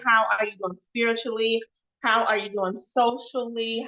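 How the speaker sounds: ordinary talk, nothing marked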